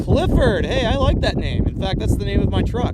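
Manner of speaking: bright, loud, delighted call-out; light up at a viewer's name; excited greeting. Real, not acted.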